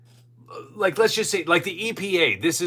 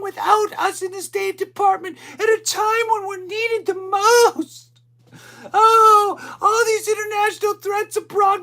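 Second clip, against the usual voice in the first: mock crying staffer; 6 dB louder than usual. Mocking fired employees who hug and cry, high wailing falsetto. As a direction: whine it like a sobbing bureaucrat.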